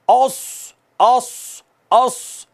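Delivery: औस with a full, sharp whistling s; demonstrating whistled sad; whistled heavy s. Shown as a demonstration. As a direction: round the vowel and hiss the s in औस. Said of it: "सीटी है".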